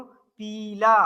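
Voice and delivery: पीला with the ई stretched out long. Shown as a demonstration. Teacher showing students a long vowel, slow and stretched. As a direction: draw out the ई in पीला. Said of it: "ई का उच्चारण एकदम स्पष्ट हो रहा है".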